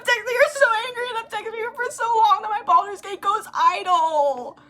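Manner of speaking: real frustration spilling out in a high, loud rush; worked-up rant. Real, not acted. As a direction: vent it, wound up and fast. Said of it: "I'm so mad".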